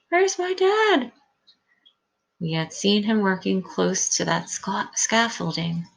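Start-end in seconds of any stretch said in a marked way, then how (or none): 0.1-1.1 put-on very high, frantic child's cry; escalating panicked scream, held back in volume. A narrator's reading. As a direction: wail it desperately, pitched way up. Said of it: "Charlie is screaming".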